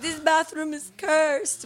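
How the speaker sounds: freaking out in a mock Italian accent; high, slow, drawn-out panicked Italian impression; Rocco. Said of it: "freaking out in Italian".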